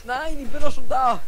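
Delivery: loud protest. Real, not acted. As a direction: shout it so they don't leave you. Protests being left behind. (lauter Protest)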